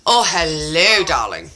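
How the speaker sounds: low, cooing hello; doting pet greeting. Real, not acted. Greeting friend's baby dog, doting and low. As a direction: croon a fond greeting to a pet.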